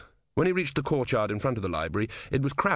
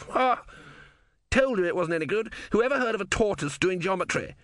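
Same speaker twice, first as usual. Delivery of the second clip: high, triumphant crowing; high, smug I-told-you-so; gloating; 9 semitones higher than usual. Acted. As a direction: rub it in. Loser crows at the crowd.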